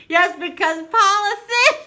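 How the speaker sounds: loud, high, laughing squeal; cracking up. Real, not acted. Cracking up at Paula's gift, high and loud. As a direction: shriek it through helpless laughter.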